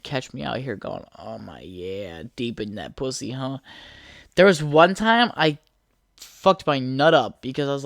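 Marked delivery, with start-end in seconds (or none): 1.2-3.6 mock moaning. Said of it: "I'm a huge moaner".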